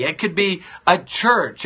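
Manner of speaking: ordinary talk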